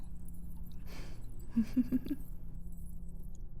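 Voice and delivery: long whispered chuckle; breathy villain laugh. Acted. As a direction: laugh at the thought of the blade. Villain gloating.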